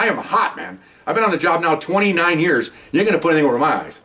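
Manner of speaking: ordinary talk, nothing marked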